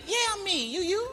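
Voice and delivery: very high, softened squeak; hammed-up squeaky comeback; mimicking a fan. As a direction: answer in mocking high voice.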